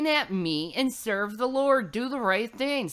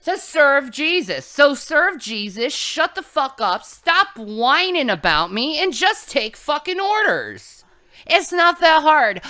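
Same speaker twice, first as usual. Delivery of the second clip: raised, louder scolding tirade; angry rant; 6 semitones higher, 6 dB louder than usual. Acted. Goddess scolding her followers to obey, angry and loud. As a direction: scold them hard and loud, pitch up.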